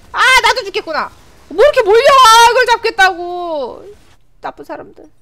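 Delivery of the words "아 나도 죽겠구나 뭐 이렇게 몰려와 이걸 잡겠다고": high, loud wail stretching out at the end; loud protest. Real, not acted. Player overrun by enemies, wailing protest. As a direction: wail at the mob hunting you.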